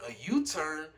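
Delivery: re-enacted surprised question on U-TURN; incredulous echo. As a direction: throw U-TURN back in disbelief. Echoes it back in disbelief.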